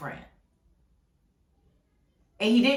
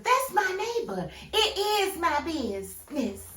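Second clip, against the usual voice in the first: nosy neighbor; 7 semitones higher, slower than usual. Retold or imitated. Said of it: "the nosy neighbor".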